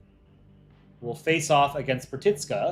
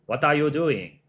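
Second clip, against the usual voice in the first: put-on Cantonese-accented English; Hong Kong accent; 3 dB louder than usual. Streamer replays the accent given to Hong Kong, Cantonese accent. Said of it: "accent I gave Hong Kong".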